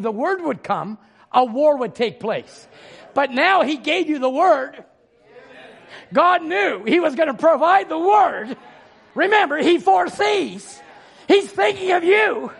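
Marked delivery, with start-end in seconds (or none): 3.1-12.5 preaching. Acted. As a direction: preach it with full force. Preaching at full force.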